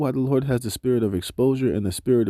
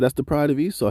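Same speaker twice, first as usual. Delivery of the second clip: ordinary delivery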